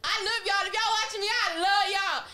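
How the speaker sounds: high, loud, excited shout-out; gushing to viewers. Real, not acted. Talking to viewers at home, loud and excited. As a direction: gush over the fans watching.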